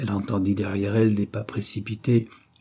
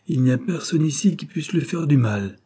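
higher-pitched, mildly haughty reply; 6 semitones higher than usual. A narrator's reading. Young man to Charlotte, the intruder, mildly haughty. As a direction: answer with a touch of disdain. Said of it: "dit le jeune homme avec hauteur".

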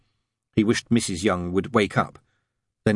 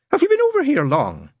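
very high nun voice; chatty nun; 4 dB louder than usual. A narrator's reading. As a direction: make small talk pitched way up. Nun makes small talk.

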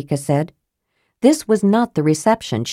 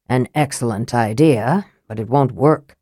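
flat; 6 semitones lower than usual. Acted. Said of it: "Raistlin said flatly".

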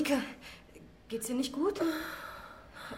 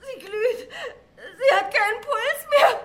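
panicked; 5 semitones higher, slower than usual. Acted. Panics over dying friend.

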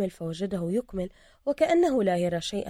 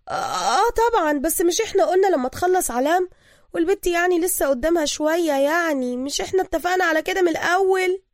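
higher, evasive female voice; evasive boss woman; 10 semitones higher, 6 dB louder than usual. Acted. Mahasen stalling the thug about the marriage, evasive. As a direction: dodge the question. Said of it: "تتحاشى النظر للدوكش".

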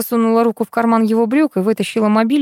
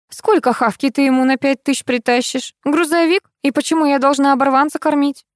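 high-pitched scolding; noisy complaining; 7 semitones higher than usual. A narrator's reading. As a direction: kick up a fuss at your son. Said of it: "зашумела Кузнецова". (шумно возмущаясь, высоким голосом)

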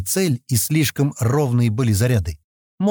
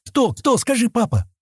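put-on high-pitched, insistent begging child; eager child; 12 semitones higher than usual. A narrator's reading. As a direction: plead excitedly for the answer. Child eagerly begs her father. (нетерпеливо, высоким детским голосом)

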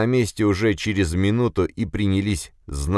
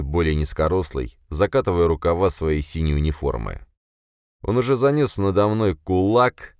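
usual speaking voice

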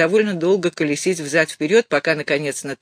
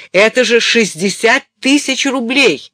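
shouting into the phone; high, loud, slow outburst; angry shout; 6 semitones higher, 7 dB louder than usual. A narrator's reading. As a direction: yell it at him, outraged. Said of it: "закричала она в трубку".

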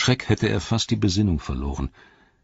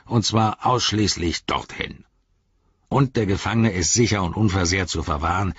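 higher, insistent orc captain, easing as the list goes on; orc reciting orders; 3 semitones higher than usual. Acted. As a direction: rattle out the regulations with authority. Voices Shagrat reciting his orders.

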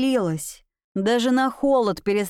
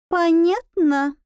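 squeaky bat voice; 6 semitones higher, 3 dB louder, slower than usual. A narrator's reading. Bat presses the point.

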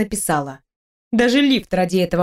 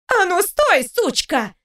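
angry shout; 8 semitones higher, slower than usual. Acted. Kate shouts after fleeing heroine.

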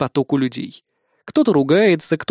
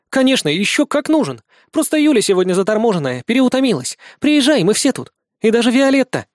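excited; 8 semitones higher, 3 dB louder than usual. Acted. Nastya grabs phone, insists.

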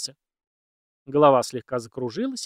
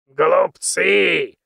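put-on loud angry old man; angry outburst; 5 semitones higher, 9 dB louder than usual. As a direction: spit it out in fury. Old man bursts out, fists strike.

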